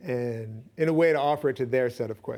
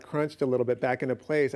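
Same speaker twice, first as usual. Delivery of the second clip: normal talking voice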